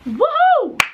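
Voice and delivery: high, loud whoop of joy; real cheer. Real, not acted. Alone on camera celebrating her pass, a loud high whoop. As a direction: let out a delighted woohoo. Celebrating passing driving test.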